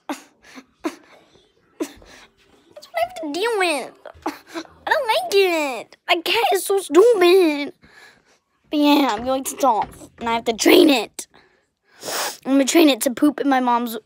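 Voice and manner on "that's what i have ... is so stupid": mock whine.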